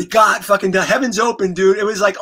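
ordinary talk, nothing marked

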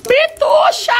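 very high, loud squealed call; excited high-pitched yell. Real, not acted. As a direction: shout the pet name in a piercing high voice. Calls out to the dog.

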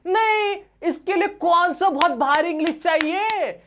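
high-pitched, exaggerated disbelief; mock protest. Teacher scoffs at needing heavy English, mock protest.

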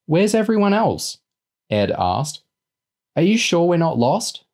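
put-on high-pitched child's questioning voice; worried kid. A narrator's reading. Young boy asking if they're lost, high kid voice. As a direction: ask it high and anxious like a small boy.

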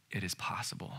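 low, very soft voice; hushed reassurance. Real, not acted. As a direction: drop to a near whisper. Quiet reassurance after insisting.

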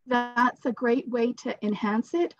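ordinary talk

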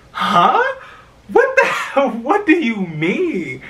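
high, loud retold disbelief with a laugh; incredulous. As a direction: act out being floored, stretching the question. Retold shocked reaction to insult.

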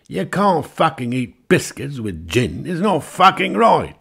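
high, loud indignation; indignant rant. Acted. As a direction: scold them like it's a crime. Englishman lectures ladies.

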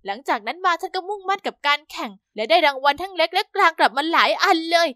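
ordinary delivery

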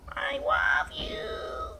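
very high, slow breathy whisper "I love you". Shown as a demonstration. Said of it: "modelling for cat".

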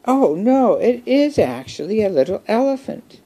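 high-pitched amazed squeak; high, dismayed surprise; astonished realization. A narrator's reading. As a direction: voice leaps up in wonder. Elephant discovers the toy is an elephant.

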